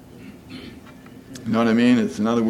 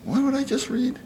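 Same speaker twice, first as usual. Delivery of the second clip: confused reader. Retold or imitated. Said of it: "and then they're gonna go".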